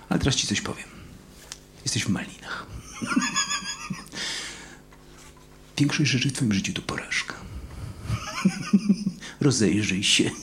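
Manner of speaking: soft, low sneering whisper; whispering accuser. Retold or imitated. Preacher voices the accusing voice John heard, sneering whisper. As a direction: hiss the put-down softly, like a tempter. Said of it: "to był ten głos, który usłyszał Jan Chrzciciel".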